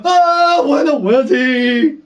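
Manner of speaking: high, slow mock-grovelling; bow down in a hammed-up wail; awed Dothraki. Dothraki grovelling before Daenerys, mock awe. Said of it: "they'll go".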